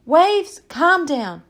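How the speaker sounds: high, loud calls to the wind; pretending to shout down a storm, commanding. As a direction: order the waves to hush. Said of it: "if you stood in a big storm and said".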